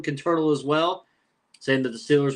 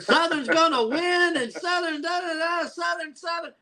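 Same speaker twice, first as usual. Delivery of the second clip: high chanted mock cheering, much higher; mock homer cheer; 14 semitones higher than usual. Mocking a friend's team hype with a high chant. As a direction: chant it like an overexcited fan. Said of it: "and he was like".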